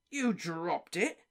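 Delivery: slow, drawn-out doubt; Diggory doubting Harry's story; disbelief. Acted. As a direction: repeat it slowly, not believing. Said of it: "repeated Mister Diggory in disbelief".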